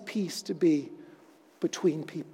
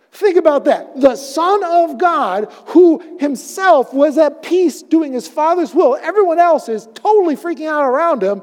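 loud, high preaching; preaching at full force; 9 semitones higher, 8 dB louder than usual. Acted. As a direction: build it up, preach it out. Preaching build-up.

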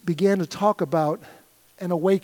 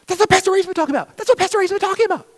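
fast, high excited gush; freaking out; 5 dB louder, much faster than usual. Retold or imitated. Churchgoer at a conference gushing, fast and high. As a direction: rush it out thrilled. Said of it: "starts freaking out".

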